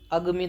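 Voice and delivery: अगमी without either long aa; wrong short-vowel form. Shown as a demonstration. Teacher rejecting a misspelt option, mispronounced on purpose. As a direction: say अगमी with short a in the first two syllables. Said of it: "तो होता नहीं है".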